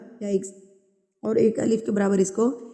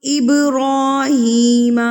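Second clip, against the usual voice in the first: slow, louder, melodic tajweed chant; Quran recitation; 4 semitones higher, 6 dB louder, much slower than usual. Shown as a demonstration. (تلاوت کا انداز)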